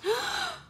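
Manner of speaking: sudden gasp of dismay; gasping at a makeup mistake; sharp high gasp. Real, not acted. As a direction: catch your breath in shock. Eyeliner slip on nose.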